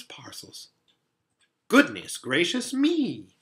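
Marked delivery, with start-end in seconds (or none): 1.7-3.3 higher, louder and drawn out; fussy creature taken by surprise; startled exclamation. A narrator's reading. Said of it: "exclaimed the fawn".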